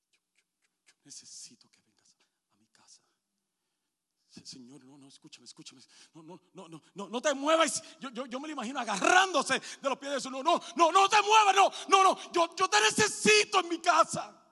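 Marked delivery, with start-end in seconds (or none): 0.1-6.4 susurro desesperado; súplica susurrada. Acted. Jairo begs Jesus, whispered. 10.3-14.4 grito mucho más alto y fuerte; grito suplicante. Acted. Jairo clings to Jesus' feet.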